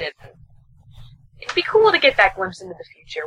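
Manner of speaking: ordinary delivery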